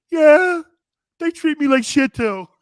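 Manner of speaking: loud put-on whine, acting out a player's reply; half-hearted whining player; mock whiny player.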